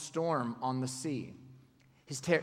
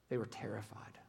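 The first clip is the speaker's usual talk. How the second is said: soft, unvoiced whisper; 6 semitones lower, 11 dB quieter than usual. Preacher describing terrified crowd, whispered. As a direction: breathe it out in awe.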